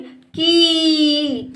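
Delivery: की slow, with sustained ee; held long. Shown as a demonstration. Teacher drills pupils on का plus matra, held long. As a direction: stretch out the ee in की. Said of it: "हम देर तक करते हैं".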